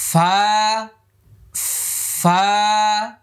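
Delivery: airy ফা held one beat; airy letter demo. Shown as a demonstration. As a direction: breathe out ফা between teeth and lip. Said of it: "মানে শেষে ফা ছিল".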